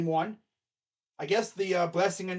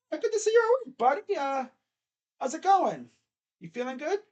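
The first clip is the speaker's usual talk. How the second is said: a much higher, sing-song bedside manner; cheery doctor; 10 semitones higher than usual. Acted. Doctor welcomes a coma patient back, hammed-up high cheer. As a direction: coo at the patient like an over-friendly doctor. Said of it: "the doctor's like".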